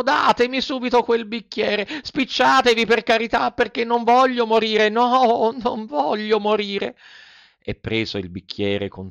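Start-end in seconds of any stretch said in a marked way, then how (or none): 0.0-7.0 very high, pleading puppet squeal; shrieking Pinocchio. A narrator's reading. Puppet begs for the glass before dying, panicked. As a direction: beg for your life in a high wail. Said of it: "cominciò allora a strillare il burattino".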